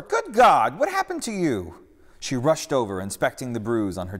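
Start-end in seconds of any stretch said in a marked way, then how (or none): 0.0-1.8 high, loud horrified gasp; shocked alarm. A narrator's reading. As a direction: react to the bruise with shock. Joanna sees the bruise.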